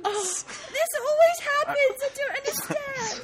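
high-pitched, put-upon complaint; exasperated wail. Acted. Child bewailing that guards are called, high exasperated whine. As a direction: lament it as a hammed-up whine.

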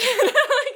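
sudden high laughing burst; loud high laugh. Real, not acted. Laughs at her own answer.

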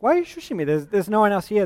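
high, indignant protest. Retold or imitated. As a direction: protest being shushed. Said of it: "And I'll say, like".

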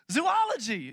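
Zoology said "zoo-OL-ogy"; high Zoology with "zoo" then "OL"; wrong pronunciation. Shown as a demonstration. Preacher showing the common mistake, Zoology as "zoo-OL-ogy". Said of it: "You say it like that".

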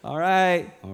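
high, loud, gushing promises to hang out; mock a friend who means none of it; over-eager promise. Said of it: "one of the other persons say".

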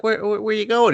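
lightly voiced kid, pitched high; kid's questioning voice. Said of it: "Michael's like".

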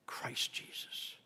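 whispered, slow reverent name; hushed whisper. Acted. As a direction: drop to a whisper on Christ Jesus. Climax of sermon point.

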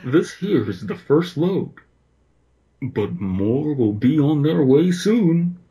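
lightly voiced creepy mascot; creepy bear voice. A narrator's reading. Mister Bear speaks in creepypasta.

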